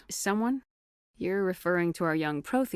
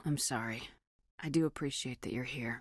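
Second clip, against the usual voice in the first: softer, hushed and sincere; soft apology; 4 semitones lower, 7 dB quieter than usual. Acted. Commander quietly apologizing to a lieutenant, soft and sincere. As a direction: apologize gently, voice dropped low.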